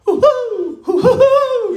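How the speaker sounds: high hooting owl call; mock owl hoots, high and long; owl call imitation. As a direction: hoot like a great horned owl. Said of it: "this whole call".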